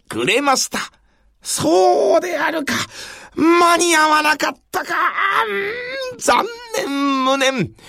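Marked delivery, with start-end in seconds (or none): 1.4-7.7 higher crying lament; anguished lament. Acted. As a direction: wail the loss theatrically. Nobunaga laments lateness.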